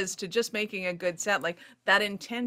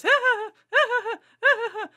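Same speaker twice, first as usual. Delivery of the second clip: high, loud mock cackle; hammed-up shrill ha-ha laugh; imitated laughter; 7 dB louder than usual. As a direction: ham up the editors laughing at you. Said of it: "All I heard was".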